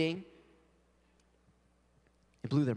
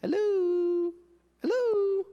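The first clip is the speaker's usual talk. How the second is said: high, louder sing-song "hello, hello"; Jesus calling hello; 12 semitones higher, 4 dB louder, slower than usual. Retold or imitated. Jesus calling to a distracted believer, high sing-song. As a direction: call out brightly, trying to get attention. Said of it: "Jesus is right there saying".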